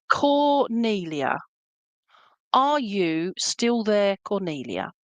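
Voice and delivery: slow, drawn-out call of the name. Real, not acted. Medium calls slowly to check a caller is still there. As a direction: stretch the name out to reach her.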